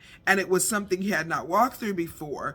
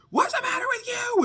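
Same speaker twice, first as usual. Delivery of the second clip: yelled complaint. Retold or imitated. Commotion heard in the house.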